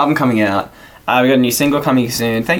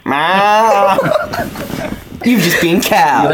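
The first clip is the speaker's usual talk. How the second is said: calling out; 6 semitones higher, much slower than usual. Pretend. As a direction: call his name across the line. Second prank call.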